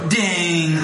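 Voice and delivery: a bell ringing in the guru's head, playful; bell ding imitation. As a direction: ring out like a little bell. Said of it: "a bell go".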